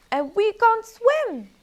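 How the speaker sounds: high, loud and slow; slow high wail. Acted. Fox complaining about the pond, high, loud and slow.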